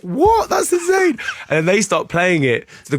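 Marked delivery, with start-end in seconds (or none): 0.0-1.2 very high, squealed surprise; shocked amazement. Retold or imitated. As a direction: squeal it in disbelief. Her own shocked reaction retold.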